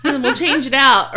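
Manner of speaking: normal talking voice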